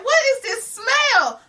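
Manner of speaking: very high, slow, stretched question; disgusted disbelief. Retold or imitated. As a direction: pull the words out high in disgust. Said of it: "And I'm like".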